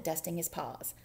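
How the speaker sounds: ordinary delivery, nothing marked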